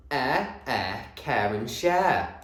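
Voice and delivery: slow, drawn-out words. Shown as a demonstration. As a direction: stretch each word out.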